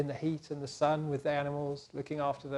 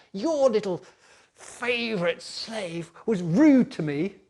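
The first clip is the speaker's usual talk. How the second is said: louder, slow, venomous tattling; spiteful complaint; 5 semitones higher, 7 dB louder, slower than usual. Acted. Potiphar's wife complaining to her husband, slow and spiteful. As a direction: drag out the accusation spitefully.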